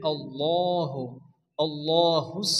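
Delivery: আল্লাহু with a dark, rounded "law"; full heavy lam. Shown as a demonstration. Teacher answering how it is read, full rounded lam in আল্লাহু. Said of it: "পুর অর্থাৎ ল পড়তে হয়".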